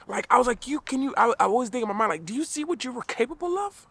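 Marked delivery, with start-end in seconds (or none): 0.7-2.2 incredulous. Retold or imitated. Acting out his incredulous thought. 2.2-3.9 very high-pitched, disbelieving question; incredulous plea. Retold or imitated. Speaker in his mind to friends about their potential, incredulous.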